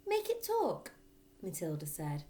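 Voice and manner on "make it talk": put-on eager girl. A narrator's reading. Matilda asks Fred.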